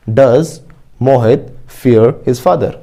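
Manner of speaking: very slow, word by word, for students to repeat; slow model sentence. Shown as a demonstration. Teacher modelling an answer for the class, very slowly. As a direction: spell each word out slowly like a dictation.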